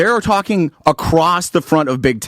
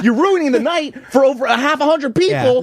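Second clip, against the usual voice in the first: fast, high mock lecture at the heckler; high exasperated rant; 12 semitones higher, faster than usual. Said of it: "and I explained to him".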